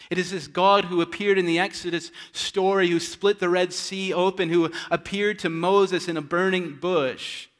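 loud, rising sermon cry; fervent preaching. Acted. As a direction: preach it out with full force to wake them. Preacher building to full force.

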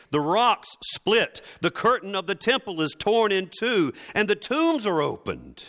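high, loud, building proclamation; full-force preaching. Real, not acted. Pastor telling the congregation of the cross, fervent preaching. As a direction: preach it like the earth is shaking.

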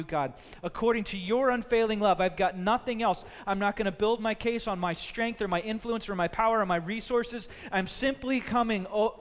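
building preacher's cry to God; preaching. Acted. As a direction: pour it out as full surrender. Voices David's surrendered prayer.

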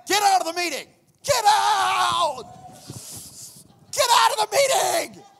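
high, loud, urgent command; urgent shout. Retold or imitated. Urgent text to get out.

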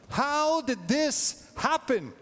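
high, slow, pleading How did this happen; anguished cry. Retold or imitated. Jeremiah crying out to God over the destruction, anguished and high. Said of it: "That's what Jeremiah said".

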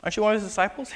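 put-on high, questioning little girl voice; high-pitched child's questioning. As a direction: pipe up like a curious girl. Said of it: "there's a little girl at the temple gates that says".

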